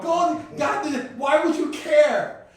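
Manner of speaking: loud, high-pitched incredulous outburst; exasperated shouting. Real, not acted. Man arguing God wouldn't care about gold, exasperated shout. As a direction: shout it in sheer disbelief.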